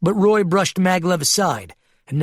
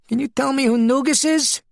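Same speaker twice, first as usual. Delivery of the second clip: much higher, reedy clever voice; high-pitched character voice; 11 semitones higher than usual. Acted. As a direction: voice him high and precise. Keen questions the sheep.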